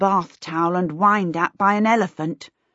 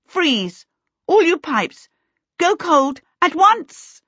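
high, loud bursts of command; screamed commands; 9 semitones higher, 5 dB louder, slower than usual. A narrator's reading. Charmaine commands the overheating pipes, screaming. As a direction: build to a high, drawn-out yell. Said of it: "she screamed, waving both arms".

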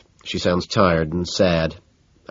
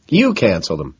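ordinary delivery, nothing marked

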